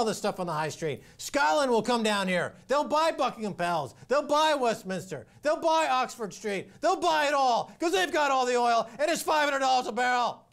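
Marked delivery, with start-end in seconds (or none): 1.2-10.5 excited. Pretend. Mock fantasy of Scots buying London.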